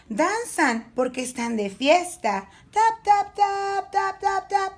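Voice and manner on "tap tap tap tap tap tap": high, rhythmic tapping syllables; dancing tap sounds. A narrator's reading. Narrator mimics party dragons dancing, rhythmic high taps. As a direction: tap out the dance steps in a high voice.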